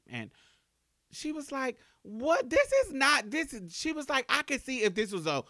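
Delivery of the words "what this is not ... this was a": loud, much higher indignant rant, stretching key words; friends ranting. Retold or imitated. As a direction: insist hard she's plainly a girl. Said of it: "This is what my friends are saying".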